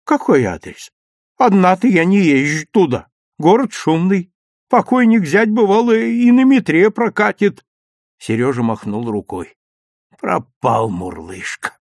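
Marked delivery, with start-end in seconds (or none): 0.0-7.6 lightly voiced granny, raised pitch; lively, louder elderly character; chatty old woman. A narrator's reading. 10.2-11.8 low, slow, hopeless sigh of words; dejected. A narrator's reading. Boy gives up cat as lost.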